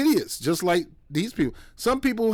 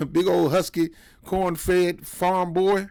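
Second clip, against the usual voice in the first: ordinary talk, nothing marked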